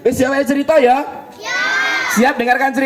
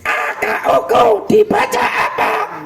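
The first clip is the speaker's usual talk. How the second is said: teacher spelling out; 12 semitones higher than usual. Shown as a demonstration.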